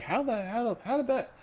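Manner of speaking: imitated bewildered tech worker; baffled disbelief. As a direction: act out puzzling over a broken system. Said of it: "and you're like".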